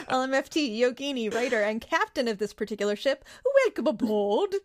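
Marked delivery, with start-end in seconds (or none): none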